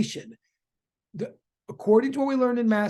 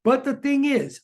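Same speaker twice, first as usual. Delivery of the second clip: ordinary delivery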